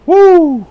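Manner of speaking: high, loud "woo"; overwhelmed whoop. Real, not acted. Preacher moved by the love of Yah, overwhelmed whoop. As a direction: let out a sudden praise whoop.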